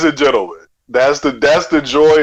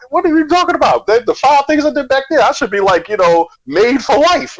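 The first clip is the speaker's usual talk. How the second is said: mock indignant; 10 semitones higher than usual. Mock complaint about ungrateful people.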